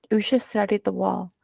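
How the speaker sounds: usual speaking voice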